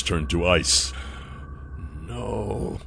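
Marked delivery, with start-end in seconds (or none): 2.1-2.8 stunned murmur. A narrator's reading. Nath sees his own scales, murmuring softly. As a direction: murmur it under your breath. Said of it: "he murmured".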